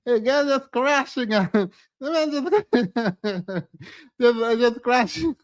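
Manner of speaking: helpless giggles between words; laughing while talking. Real, not acted. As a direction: try to get words out through real laughter. Cracking up at own impression.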